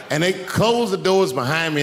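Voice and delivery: normal talking voice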